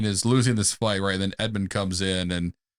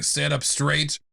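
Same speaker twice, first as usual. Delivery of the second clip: ordinary talk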